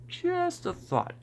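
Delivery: Just a thought said high and slow; mock squeak. Shown as a demonstration. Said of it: "repeat in squeaky voice".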